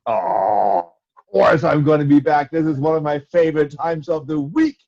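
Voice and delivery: ordinary delivery, nothing marked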